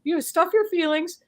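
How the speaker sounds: high, loud mocking singsong; mock New Englander. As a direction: brush it off like a prim New Englander.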